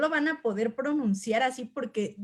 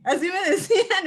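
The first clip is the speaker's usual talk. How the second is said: words broken by a higher, louder laugh; 8 semitones higher, 6 dB louder than usual. Real, not acted. Teacher recounting students' nickname, laughing aloud. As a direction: laugh your way through it.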